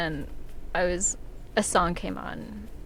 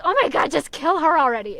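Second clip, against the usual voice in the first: exasperated readers; 5 semitones higher, 8 dB louder than usual. Retold or imitated. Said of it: "and they're like".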